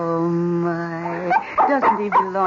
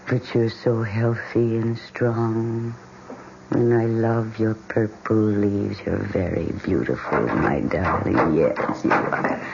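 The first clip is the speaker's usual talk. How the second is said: slow, lower doting voice; tender praise; 7 semitones lower, slower than usual. Acted. Wife dotes on her purple plant, slow and tender.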